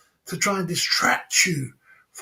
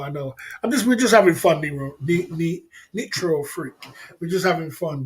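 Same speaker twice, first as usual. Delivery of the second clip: ordinary delivery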